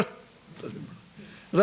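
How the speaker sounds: slow, drawn-out whisper; hushed, slowed whisper of a prayer cue. Acted. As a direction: breathe the words out slowly. Echoed command repeated softly.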